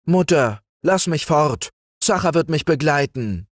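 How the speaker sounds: put-on high-pitched boyish plea; lightly acted urgent request; pleading. A narrator's reading. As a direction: beg your mother for permission. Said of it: "bat er".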